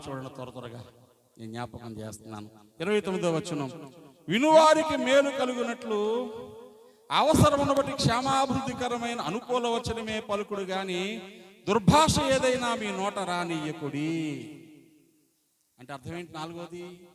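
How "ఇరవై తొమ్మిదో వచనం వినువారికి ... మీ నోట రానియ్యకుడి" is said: loud, slow scripture proclamation; booming pulpit reading of the Bible; preaching at full force. Acted. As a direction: declaim the verse to the whole church.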